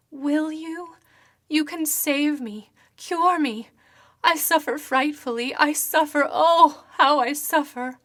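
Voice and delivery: a suffering apparition begging for help; ghostly woman, anguished and imploring; pleading ghost woman. Acted.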